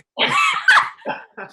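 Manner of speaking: a sudden high, loud burst of laughter. Real, not acted.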